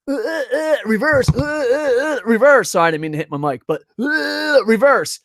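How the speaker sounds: high, mock-strained grunts, imitating wrestlers straining through a reversal. Describing a wrestling reversal, high strained grunts.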